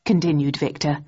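plain reading voice

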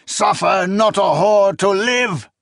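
high loud chanted scripture; reciting scripture. A narrator's reading. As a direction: recite it like a sermon curse. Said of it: "the old priest recited".

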